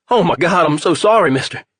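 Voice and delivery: high, loud frantic apology; distraught apology. A narrator's reading. Delivery guy apologizing frantically to the father, loud. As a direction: blurt the apology in panic. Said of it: "the guy kept saying".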